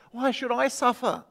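softly murmured, sullen. A narrator's reading. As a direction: murmur it under your breath like a sulking schoolgirl. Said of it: "she murmured".

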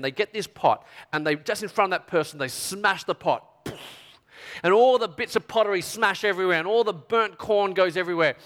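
ordinary delivery

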